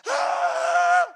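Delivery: long, high wailing cry; loud wail. Acted. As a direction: wail out loud on one held high note. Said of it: "watch him wail that day".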